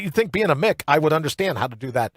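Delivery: normal talking voice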